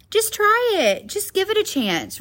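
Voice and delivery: high-pitched coaxing pep talk; encouraging. Acted. What we tell kids or a best friend, encouraging. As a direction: lift the pitch, urging someone to give it a go. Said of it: "we encourage others".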